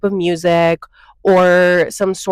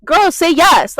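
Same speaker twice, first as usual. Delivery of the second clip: much higher, sassy urging; exasperated mock; 10 semitones higher, 3 dB louder, slower than usual. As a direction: nudge the crowd with exasperated sass. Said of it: "people are literally just like".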